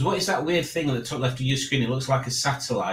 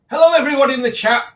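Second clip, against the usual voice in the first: excited greeting; 12 semitones higher, 8 dB louder, faster than usual. Real, not acted. Greeting livestream chat loudly.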